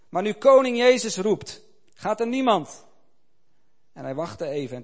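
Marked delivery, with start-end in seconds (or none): 0.1-2.9 much louder, higher rhetorical cry; ringing challenge. A narrator's reading. Old missionary challenges the assembly, loud and high. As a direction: shame the silent hall.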